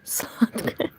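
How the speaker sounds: breathy whisper with a small laugh; whispered laugh at a cheeky compliment. Real, not acted. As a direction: giggle it under your breath. Repeats viewer's flirty word.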